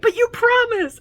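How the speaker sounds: very high, loud imitated whine; mock childish protest. Friend reminds her of the promise, mock pleading protest. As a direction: plead like a let-down kid, hammed up.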